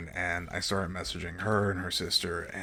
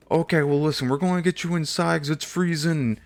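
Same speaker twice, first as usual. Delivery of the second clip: much higher, louder chummy attendant voice; pushy attendant; 8 semitones higher, 10 dB louder than usual. Retold or imitated. Attendant accosting the waiting traveller, higher and louder. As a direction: play an overbearing airport worker.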